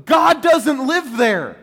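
loud, slow, pointed proclamation; emphatic declaration. Retold or imitated. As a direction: proclaim it slowly and loudly, pointing up. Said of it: "points up to the Acropolis and goes".

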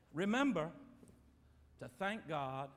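usual speaking voice

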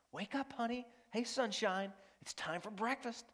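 put-on a tender morning wake-up call to a little girl; tender parent voice. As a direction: coax a sleepy daughter gently awake. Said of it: "what you would say to your daughter".

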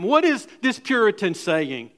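rising, impassioned pulpit cry; preaching at full force. Acted. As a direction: drive it home like a revival preacher. Preaching climax.

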